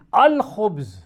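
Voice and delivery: الخبز read as al-khubz with a throaty kh; Arabic al-khubz. Shown as a demonstration. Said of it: "الف لکھا جا رہا ہے لیکن وہ پڑھا نہیں جا رہا".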